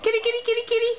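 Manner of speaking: high, lilting call for the cat; sing-song kitty kitty, high and light. Real, not acted. Calling the cat to come.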